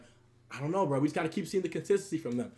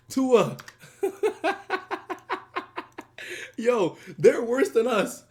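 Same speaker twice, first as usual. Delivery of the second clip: slow, louder, laughing; 6 semitones higher, 7 dB louder, much slower than usual. Real, not acted. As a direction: stretch it out in disbelief while laughing. Laughing at own team.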